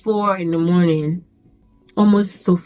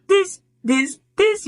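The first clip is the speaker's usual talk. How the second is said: each this climbing much higher; rising strain; 12 semitones higher, slower than usual. Retold or imitated. Recruit straining under bags, climbing higher. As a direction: push each word higher as you strain. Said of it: "you're like".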